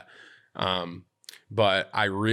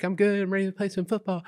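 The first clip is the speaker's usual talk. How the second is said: put-on high, quick eager kid; ten-year-old voice; 10 semitones higher than usual. Kid greeting the football coaches, high ten-year-old voice. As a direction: play a keen little boy, laugh creeping in. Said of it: "so I'm ten".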